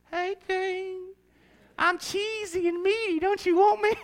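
high, coaxing voice of a pizza calling him; tempting pizza voice. Acted. Pizza luring the dieting preacher, high seductive voice. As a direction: purr it like a pizza flirting with you.

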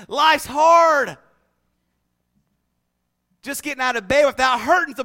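loud shouted refrain; preaching at full force. Acted. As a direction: rouse the church to an amen. Preaching, amen call.

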